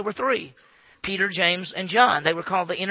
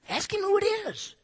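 much higher, pushing urgently; high exaggerated urging. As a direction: squeak it out insistently. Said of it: "he probably went".

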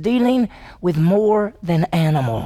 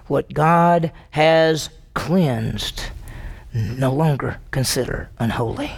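slow, measured voice of God, dropping low; 6 semitones lower, slower than usual. Retold or imitated. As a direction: quote God slowly, with long pauses. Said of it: "a voice came to him a second time".

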